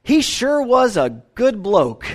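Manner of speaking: put-on slow, broad Australian drawl as the old babysitter; Australian accent. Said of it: "in her strong Australian accent".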